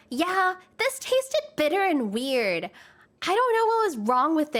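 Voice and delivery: high, whiny mock complaint; complaining customer. Customer returning an empty cup, complaining. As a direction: imitate a customer griping, stretched out then hurried.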